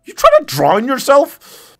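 high, loud, slow disbelief; incredulous scolding. Real, not acted. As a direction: ask it like you can't believe the dog. Dog in water.

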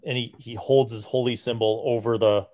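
ordinary talk, nothing marked

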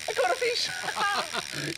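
slow, high, stretched cry of joy; drawn-out excited cry. Acted. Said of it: "repeated slow".

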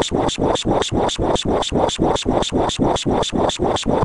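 breathy unvoiced swa swa noise, rhythmic; whispered swishing noise. Narrator mimics a sound effect, unvoiced rhythmic swa. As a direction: hiss out a steady swa swa swa.

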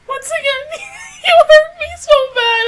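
high, wailing laugh-sob; real cry-laugh. Real, not acted. Fan crying out to the show that hurt her. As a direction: wail it through tears and laughter.

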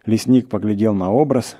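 usual speaking voice

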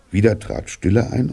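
ordinary delivery, nothing marked

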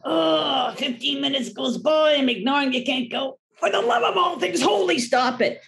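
high, strained outburst of lost patience; exasperated caving in. Acted. Owner cracking while trying to ignore the dog, exasperated. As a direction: give up ignoring and plead in exasperation.

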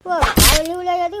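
loud sobbing wail; wailing cry. Acted. Wailing over the fight. (ಜೋರಾಗಿ ಅಳುತ್ತಾ)

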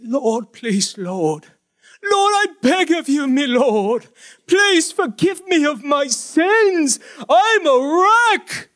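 high, slow, wailing plea to the Lord; mock begging prayer. Preacher mocking how we beg God, hammed-up wailing plea. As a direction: overdo a desperate beggar's prayer. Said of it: "We beg God".

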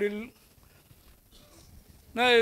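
ordinary talk

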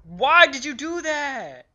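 loud, slow protest; dismayed yell. Real, not acted. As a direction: wail the question at a teammate. Protesting teammate's blunder in game.

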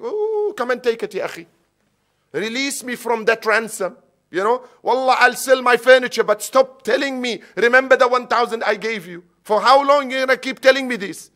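high, loud acted exasperation; exasperated outburst. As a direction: play a debtor fed up with being reminded. Acted exasperated reply to nagging lender.

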